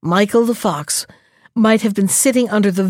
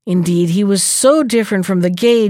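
usual speaking voice

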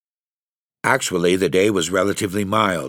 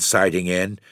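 ordinary delivery

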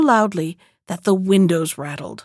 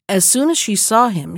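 plain reading voice

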